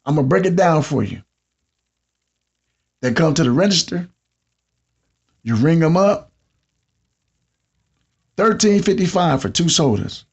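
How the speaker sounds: ordinary talk, nothing marked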